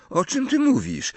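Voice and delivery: very high puzzled question; baffled. A narrator's reading. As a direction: ask in bewilderment, pitch shooting up. Zaskoczone pytanie o pomysł. (zdumione pytanie, bardzo wysokim głosem)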